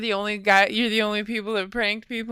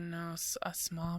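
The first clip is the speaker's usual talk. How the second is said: whisper; 3 semitones lower, 13 dB quieter, slower than usual. Real, not acted. Correcting a word under breath.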